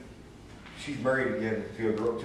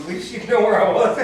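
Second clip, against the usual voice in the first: higher, louder, laughing through the joke; laughing quip; 11 semitones higher, 12 dB louder than usual. Real, not acted. Joking to the group about his whereabouts, laughing. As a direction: toss off a wry joke while laughing.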